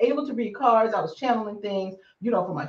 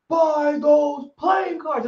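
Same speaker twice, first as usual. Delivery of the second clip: booming voice; 6 semitones higher, much slower than usual. Retold or imitated. Spirit commands her again, slow shouted boom. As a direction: call it out like a voice from nowhere. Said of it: "I hear this big booming voice like".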